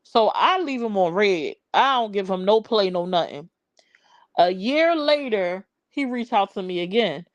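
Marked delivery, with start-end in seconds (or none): none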